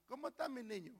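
very soft, tender greeting; acted fond parent, hushed; pleased parent. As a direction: coo it gently to your own child. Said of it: "Cuando usted está contento con su hijo".

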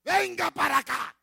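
high, loud call home; mother's shout. Acted. Mother calling her child to come here, shouting. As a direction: yell it across the yard.

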